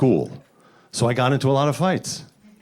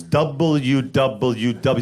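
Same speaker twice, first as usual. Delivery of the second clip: slowed down; much slower than usual. Acted. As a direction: spell each letter out painfully slow. Exasperated over long abbreviation.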